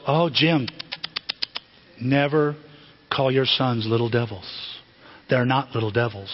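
low, measured reproof; solemn nun admonishing. Retold or imitated. Nun correcting dad about his sons, solemn gentle reproof. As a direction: pause gravely between phrases, lightly voiced nun. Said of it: "one of the sisters goes".

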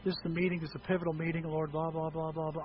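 low, soft monotone rattling; mumbled going-through-the-motions prayer; rote prayer. Retold or imitated. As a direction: drone through a routine prayer dismissively. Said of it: "And I didn't say".

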